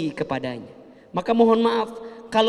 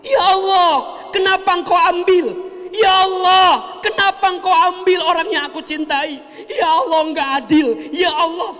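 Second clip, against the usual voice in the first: wailing angry lament; 8 semitones higher, 6 dB louder than usual. Retold or imitated. Grieving mother wails angrily at God over her loss. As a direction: howl it out like a grieving mourner cursing fate. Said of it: "pas nangis sambil ngumpat".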